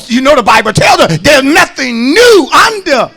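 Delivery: loud, high shouted preaching to the congregation; full-force preaching. Acted. Pastor shouting to his congregation, full-force preaching. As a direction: preach it out, pushing hard on every phrase.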